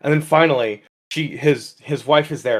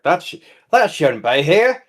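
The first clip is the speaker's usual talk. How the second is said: higher-pitched, put-on Australian; Australian accent; 4 semitones higher, 4 dB louder than usual. Man in the woods spots a capybara, accidentally Australian. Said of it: "I don't know why he's Australian".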